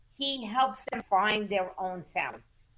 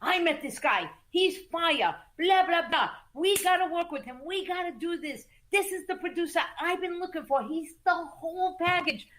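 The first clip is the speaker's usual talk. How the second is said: imitating her own high-pitched excited pitch; retold hype; 7 semitones higher than usual. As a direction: hype it up like selling a star. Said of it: "So I told Tanisha".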